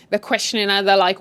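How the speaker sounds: normal talking voice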